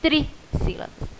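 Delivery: Trissílabas whispered syllable by syllable; Trissílabas in a soft whisper; whispered word. Shown as a demonstration. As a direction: breathe out Trissílabas softly. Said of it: "três palavras trissílabas".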